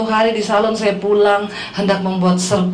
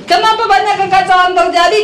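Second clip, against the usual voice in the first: high, insistent raised-voice question; louder prompt; 9 semitones higher, 4 dB louder than usual. Shown as a demonstration. Preacher asking congregation to speak up, raised insistent question. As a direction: call it out to rouse the crowd. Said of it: "Yang kenceng ngomongnya".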